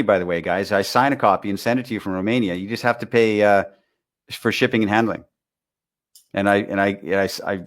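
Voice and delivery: ordinary talk, nothing marked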